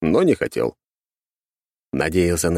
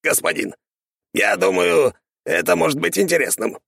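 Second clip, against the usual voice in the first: skeleton servant voice; 12 semitones higher than usual. A narrator's reading. Skeleton servant addresses master.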